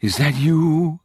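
haunting, slow singsong call; eerie ghost voice. A narrator's reading. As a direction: draw the words out like a spook calling. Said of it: "an eerie whisper murmured from the closet".

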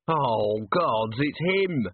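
slow, drawn-out groan of recognition; moaned dismay. A narrator's reading. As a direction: moan it in dread, stretching the words. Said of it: "moaned one of the men".

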